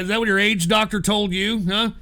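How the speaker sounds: normal talking voice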